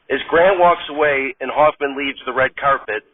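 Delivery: usual speaking voice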